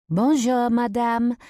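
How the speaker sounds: high, loud sweet greeting; sweet-voiced French waitress. A narrator's reading. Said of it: "a sweet voice interrupts us".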